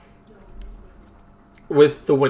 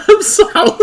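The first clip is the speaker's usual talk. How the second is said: high squeaky laugh, apologising; 13 semitones higher, 8 dB louder than usual. Real, not acted. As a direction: crack up at yourself. Laughs after own outburst.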